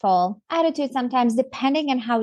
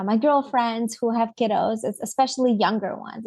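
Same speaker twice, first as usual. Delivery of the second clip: ordinary talk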